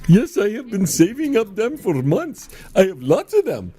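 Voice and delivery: lightly voiced high-pitched clown. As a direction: put on a silly high clown voice. Clown character in poker sketch.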